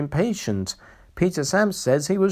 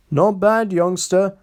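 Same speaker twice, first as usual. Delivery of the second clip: lofty; 7 semitones higher, 4 dB louder than usual. A narrator's reading. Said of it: "said Henry loftily".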